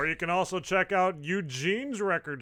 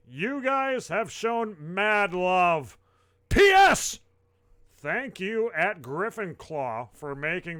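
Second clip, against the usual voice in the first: higher, emphatic read-aloud; performed post reading; 5 semitones higher, 8 dB louder than usual. Acted. Host reading a musician's thank-you post, emphatic announcer style. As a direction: read it out big, stretching key phrases.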